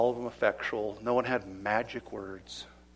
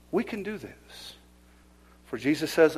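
usual speaking voice

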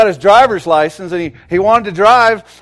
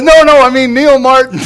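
higher and louder, hurrying to correct the crowd; flustered correction; 10 semitones higher, 9 dB louder than usual. Retold or imitated. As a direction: call out to fix the mix-up. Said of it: "And he goes".

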